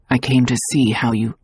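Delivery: low, hesitant apology that breaks off; a hint of an uneasy man; lightly voiced lord. A narrator's reading. Luke begins an apology.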